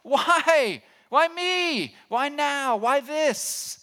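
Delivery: loud, very high acted cry; anguished high-pitched shout at God; screaming out to God. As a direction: cry out to heaven, full volume then easing slightly. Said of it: "wanting to scream out to God".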